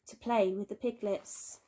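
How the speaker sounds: plain reading voice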